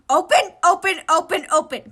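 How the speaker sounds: loud high shouts; furious shouting. Acted. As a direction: hammer out each word furiously. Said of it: "He furiously beat the egg".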